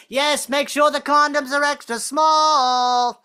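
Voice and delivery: high, slow, drawn-out yell; wife yelling. Retold or imitated. Wife yelling down six stories, teasing. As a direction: yell it down from the window. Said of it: "wife yelling down again".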